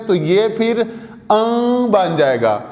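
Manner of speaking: usual speaking voice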